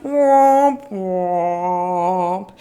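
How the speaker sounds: drawn-out womps, loud then dropping; mock sad trombone. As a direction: imitate a sad trombone sliding down. Imitated sad trombone after oversleeping remark.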